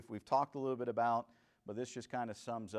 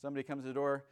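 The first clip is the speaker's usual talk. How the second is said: ordinary talk, nothing marked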